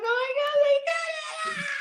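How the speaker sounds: very high wail building to a scream; acted upset outburst. Actress seeing her scene was cut, acted upset scream. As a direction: relive the shock, climbing into a high cry. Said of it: "I was, like, so upset".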